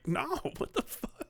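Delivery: high, hushed laughing disbelief; incredulous refusal. Acted. Actor from that area turning down the part, incredulous. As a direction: balk at the very idea in a high, small voice.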